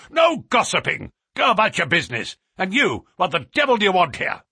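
loud, fast, high-pitched orders; fierce master bawling at intruders; angry bullying cry. A narrator's reading. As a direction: rattle out furious commands. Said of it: "he cried".

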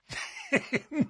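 high, light breathy chuckle; amused snicker bursting out; burst of laughter. A narrator's reading. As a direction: laugh suddenly after a moment's thought. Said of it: "burst out laughing".

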